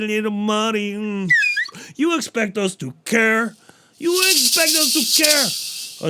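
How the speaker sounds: high, mocking self-centred voice; mocking diva. Comic mocking a self-absorbed listener, high and snooty. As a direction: sneer at the audience's silly stories. Mocks an audience, self-centered voice.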